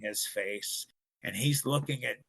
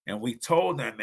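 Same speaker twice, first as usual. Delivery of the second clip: usual speaking voice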